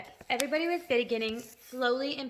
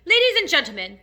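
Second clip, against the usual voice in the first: loud, higher announcement; controlled public address; 6 semitones higher, 11 dB louder than usual. A narrator's reading. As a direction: address the company, holding fear back. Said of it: "trying to keep very hard from the fear and disappointment out of his voice".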